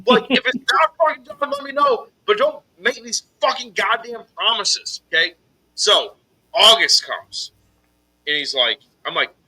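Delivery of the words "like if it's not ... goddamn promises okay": much higher, sharp angry outburst; furious rant. Retold or imitated. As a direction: blow up at the liar who keeps promising. Chewing out the statue maker.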